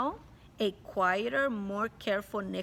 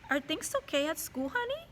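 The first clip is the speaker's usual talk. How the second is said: higher, gently asking mom voice; caring mom; 7 semitones higher than usual. Acted. Mom asks her son about school, higher and caring. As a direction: ask like a concerned mother. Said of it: "and she said".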